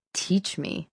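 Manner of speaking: a soft, grumbling mutter; grudging half-voiced grumble; grumbled. A narrator's reading. Sydney to Merle, resenting his lesson: soft grumble. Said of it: "I grumbled".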